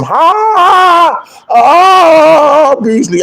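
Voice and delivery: high, loud falsetto cry; mock high notes. Teasing Rex with high notes, loud falsetto cry. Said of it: "make you start singing high notes".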